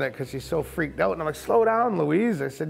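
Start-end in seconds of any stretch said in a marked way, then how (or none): 1.3-2.4 high retold holler; urgent warning. Brother warns sister nearly flipping the dinghy, urgent high call. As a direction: call her off urgently, pitch up.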